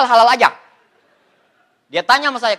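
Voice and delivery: ordinary talk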